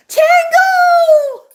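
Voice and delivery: real high, loud squeal of greeting; sudden high-pitched, drawn-out excited yell; excited shout. Real, not acted. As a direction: burst out the name, thrilled to see them. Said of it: "I got too excited".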